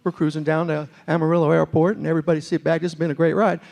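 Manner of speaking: put-on airline captain announcing in a West Virginia accent; Chuck Yeager. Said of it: "all the pilots wanna talk like Chuck".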